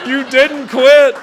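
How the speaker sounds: dad's relief retold while cracking up; laughing dad quote. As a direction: stretch it out through laughter. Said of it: "And he hugged me".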